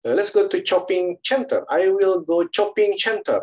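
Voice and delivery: lecturer imitating how Chinese speakers talk English; Chinese English accent. Hammed-up Chinese English accent. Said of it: "kalau kata orang Cina".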